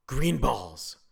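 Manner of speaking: put-on loud triumphant kid announcement; excited child shout; proud reveal. A narrator's reading. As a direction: blurt it like a child with a great idea. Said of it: "she finally revealed".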